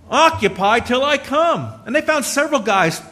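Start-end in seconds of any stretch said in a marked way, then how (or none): 0.1-1.7 higher, louder, slow order; preacher repeating the captain's order for emphasis; emphatic command. Acted. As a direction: drive home the command.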